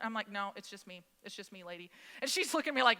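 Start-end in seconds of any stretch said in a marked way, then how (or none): 0.3-1.9 low, muted retold answer; quiet, resigned aside to the clerk; deadpan reply. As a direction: drop it low and soft, fed up. Retold curt reply to registrar.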